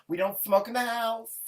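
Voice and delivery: high, loud busybody neighbor; hammed-up nosy neighbor at the door. Neighbor voiced in a story.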